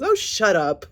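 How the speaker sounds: loud, high mocking brush-off; dismissive retort. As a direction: snap it off like you're done with her. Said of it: "She says".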